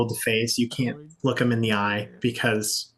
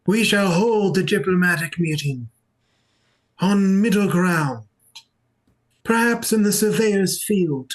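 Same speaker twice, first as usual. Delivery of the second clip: raised pitch, stately pauses between phrases; church leader proclaiming; 4 semitones higher than usual. Acted. Church leader calling members to a diplomatic meeting, ceremonious. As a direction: proclaim it formally to a congregation.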